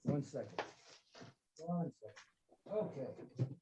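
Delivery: very soft, absent murmur; muttering to oneself. Real, not acted. Speaker hunting for the book to show listeners, muttering to himself. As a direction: say it under your breath, barely audible.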